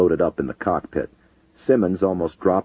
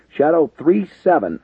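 strained pilot over the radio; tight, urgent radio call; 11 semitones higher, 6 dB louder than usual. A narrator's reading. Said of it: "radioed in a strained voice".

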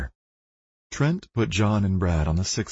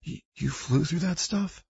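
shaken stammer; 10 semitones higher, 4 dB quieter than usual. Acted. Shaken clerk stammers in disbelief.

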